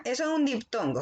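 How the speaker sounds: ordinary delivery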